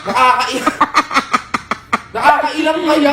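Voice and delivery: complaint breaking into a laugh; holding back laughter. Real, not acted. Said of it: "Tatawa ka na, B. Huwag mo pigilan". (nagpipigil ng tawa habang nagrereklamo)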